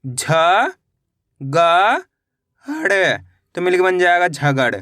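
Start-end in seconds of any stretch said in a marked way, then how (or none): 0.1-3.2 each letter drawn out, with a long pause after it; stretched, separated syllables. Shown as a demonstration. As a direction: sound out the letters one at a time for learners. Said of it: "मेरे साथ साथ इनको repeat".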